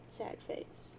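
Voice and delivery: a soft, voiceless aside; whispered emote. Acted. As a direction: breathe out sad face as a whisper.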